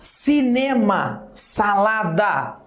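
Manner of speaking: slow, spaced-out example words; slowly, word by word for the class. Shown as a demonstration. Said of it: "são três trissílabos".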